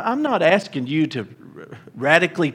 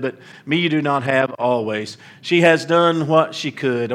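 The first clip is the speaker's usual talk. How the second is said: louder, lifted preacher's reading of scripture; 4 dB louder than usual. Acted. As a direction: proclaim the passage to the congregation. Reads scripture aloud.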